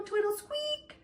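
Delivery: usual speaking voice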